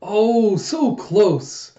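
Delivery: excited announcer voice; sports announcer. Acted. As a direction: call a near miss like a commentator. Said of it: "And announcer goes".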